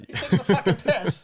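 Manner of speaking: hammed-up British accent, high and loud; laughing through a botched British accent; bad British accent. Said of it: "I can't do a British accent".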